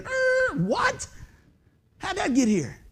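high, startled mock confusion; surprised listener. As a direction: play a baffled listener. Listener surprised by radio message.

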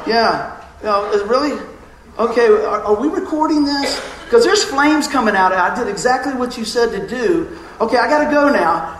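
high, loud fake alarm about flames; hammed-up panicked caller; mock panic on the phone. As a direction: play it up like the machine is burning. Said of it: "I wanted to just go".

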